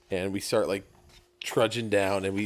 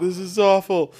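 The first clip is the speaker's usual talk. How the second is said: loud complaining whine; 10 semitones higher, 9 dB louder than usual. Retold or imitated. Miserable on thorny trail.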